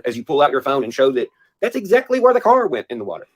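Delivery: ordinary delivery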